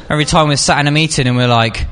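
ordinary talk, nothing marked

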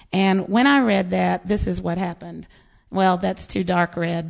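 ordinary talk

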